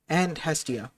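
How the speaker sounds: usual speaking voice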